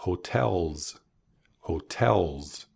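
"hotels" ending in a voiced Z buzz; buzzing final S. Shown as a demonstration. Teacher modelling the plural for learners, buzzed S in "hotels". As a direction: end "hotels" on a bee-like zzz, stress on TEL. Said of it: "final S sounds like a bee".

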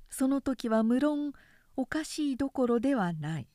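plain reading voice

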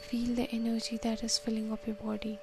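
soft, unhurried counting and calming guidance; guided meditation. Acted. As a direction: talk them gently through each breath. Leads a breathing meditation.

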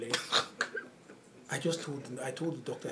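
ordinary talk, nothing marked

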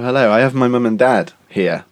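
normal talking voice

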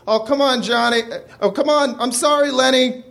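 high pleading apology; pleading Johnny. Acted. Johnny pleads at the bathroom door, high apologetic voice.

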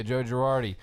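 usual speaking voice

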